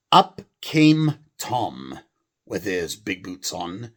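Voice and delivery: put-on English accent, slow. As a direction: announce Tom's entrance, English. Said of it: "a normal English accent".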